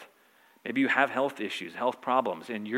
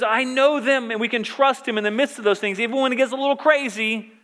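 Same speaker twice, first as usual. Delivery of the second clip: urgent pulpit delivery, pitched up; impassioned preaching; 10 semitones higher, 7 dB louder than usual. Acted. Preacher assuring the congregation of God's help in troubles, impassioned preaching. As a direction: preach it higher and insistent.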